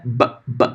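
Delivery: b, b, b as short soft pops, lips closed; teacher demoing a plosive b. Shown as a demonstration. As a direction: pop the b like a little click. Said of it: "eu estalo".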